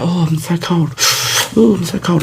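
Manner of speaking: high, put-on complaint of cold; mock shivery mum. Friend's mum outside in a house coat, high mock-shivery complaint.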